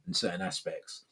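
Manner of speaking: ordinary talk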